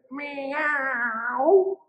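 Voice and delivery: long, high rising meow; little cat. A narrator's reading. Cat objects to the witch, long high meow. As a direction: stretch the meow upward. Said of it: "the little cat said".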